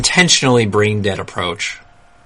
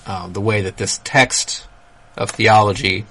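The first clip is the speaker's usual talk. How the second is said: ordinary talk, nothing marked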